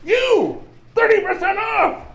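high, angry accusation; accusing shout. Acted. Gorgalax confronting the shopkeeper, high accusing shout. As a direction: point and yell at the cheat.

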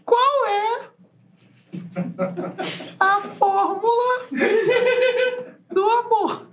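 very high, thin creature voice; high creepy hand voice. Acted. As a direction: squeak it like a headless hand. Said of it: "Essa mãozinha tá tão esquisita".

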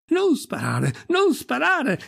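high-pitched urgent plea; alarmed cry. Acted. Priest begging Pirolo not to shoot, alarmed cry. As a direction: cry out to stop someone, voice leaping up. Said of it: "gridò l'arciprete".

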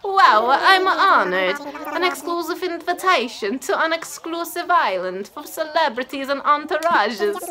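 put-on hammy, unconvincing Italian accent; Huck. Flattered camper angling to move in, mock Italian accent. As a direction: overdo a bad Italian accent. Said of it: "This is so not what Italians sound like".

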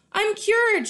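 delighted cry of relief; joyful shout. Acted. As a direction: shout it out happily. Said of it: "she shouted".

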